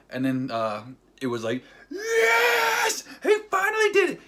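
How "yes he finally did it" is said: high, screaming cheer; loud ecstatic yell, voice pushed way up; super excited. Retold or imitated. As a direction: shout it like a fan erupting in triumph. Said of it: "Super excited about that part".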